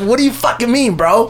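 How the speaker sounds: high, exasperated retort; mock outrage at the roommate; incredulous. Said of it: "I look at him like".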